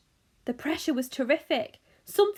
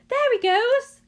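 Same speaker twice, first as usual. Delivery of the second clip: high, loud call from the crowd; excited shout; 12 semitones higher, 9 dB louder, slower than usual. A narrator's reading. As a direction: shout out the sighting. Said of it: "somebody shouted".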